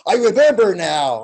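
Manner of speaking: loud, high mock holler; triumphant shout. Imitating the drummer whose beat came back, shouting. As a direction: yell it out as a sudden recollection.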